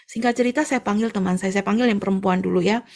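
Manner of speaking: ordinary talk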